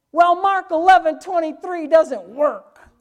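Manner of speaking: high, blaring mock protest; loud mock complaint. Preacher imitates someone complaining about scripture, loud mock complaint. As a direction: whine it out at full volume.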